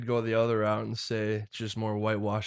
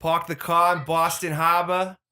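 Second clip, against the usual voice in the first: "Pahk the cah" in a higher, louder hammed-up Boston accent; 6 semitones higher, 6 dB louder than usual. Busting out a Boston accent for friends, hammed-up Boston. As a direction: drop every r, full Boston. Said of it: "bust out your Boston accent".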